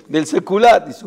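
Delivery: ordinary talk, nothing marked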